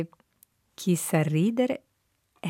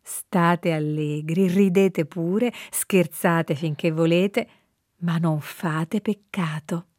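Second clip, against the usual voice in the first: slow quoted exhortation; much slower than usual. Retold or imitated. S1 quotes the saint slowly.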